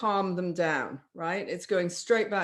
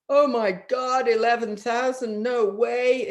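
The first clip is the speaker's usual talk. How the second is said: mock shock, louder and higher; shocked client; 4 semitones higher, 5 dB louder than usual. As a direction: gasp at the price. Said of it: "they'll go".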